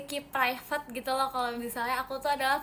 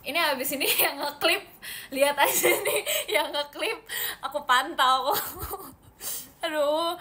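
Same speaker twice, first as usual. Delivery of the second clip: talking through laughter, higher and louder; laughing; 3 semitones higher, 5 dB louder than usual. Real, not acted. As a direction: tease them while laughing. Teases viewers who clip her. (sambil tertawa)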